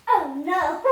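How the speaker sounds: loud mock wail; child playing out a loud "oh no"; pretend cry. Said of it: "And then I will say".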